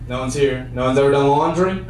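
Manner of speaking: ordinary talk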